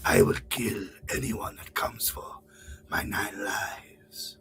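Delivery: soft, whispered cat-hero threat; Puss in Boots. Acted. Cat hero warning anyone who comes, whispered menace. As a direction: whisper it low, slowing right down at the end. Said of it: "do a Puss in Boots impression".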